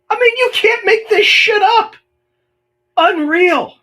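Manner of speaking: very high, loud cry of disbelief; outraged disbelief. Real, not acted. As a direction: exclaim incredulously, pitch shooting right up. Outburst after reading a shocking email.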